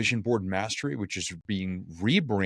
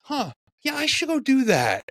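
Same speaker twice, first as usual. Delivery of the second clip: high, bright mock-impressed voice; light impression of amused friends deciding to try it; amused listeners; 3 dB louder than usual. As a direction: play the friends who are half amused, half convinced. People react to her joke.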